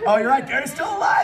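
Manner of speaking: gleeful realization; excited relief. Real, not acted. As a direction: burst out with joy. Sudden relief.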